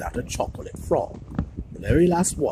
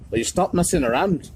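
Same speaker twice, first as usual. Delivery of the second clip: loud, higher-pitched team captain telling off his players; yelled; 6 semitones higher, 5 dB louder than usual. A narrator's reading. Wood yelling at the Weasleys, loud. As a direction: bark it at the clowning players. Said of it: "he yelled".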